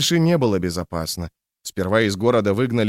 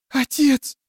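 frightened whisper. A narrator's reading. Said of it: "прошептала она".

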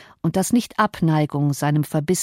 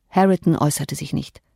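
ordinary delivery

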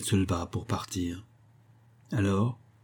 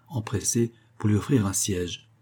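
plain reading voice

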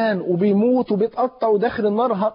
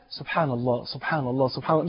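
a soft devotional murmur of the phrase; soft dhikr murmur; 5 semitones lower, 5 dB quieter than usual. Shown as a demonstration. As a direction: quietly repeat the praise, under the breath. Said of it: "يلا الكل ... نقضيها كلها". (ترديد هادئ خافت للتسبيح)

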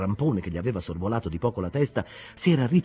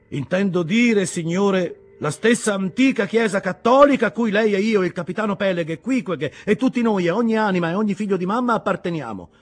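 higher, louder impassioned preaching; fervent sermon; 8 semitones higher, 5 dB louder than usual. Acted. Ishmael preaching to the captains, fervent. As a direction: preach it with conviction.

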